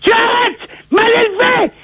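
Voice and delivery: high-pitched indignant scolding; hammed-up offended husband; acted outrage. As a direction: protest in a high, scandalised voice. Acted outrage at brother's insults.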